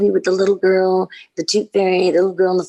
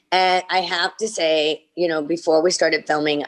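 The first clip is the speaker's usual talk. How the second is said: normal talking voice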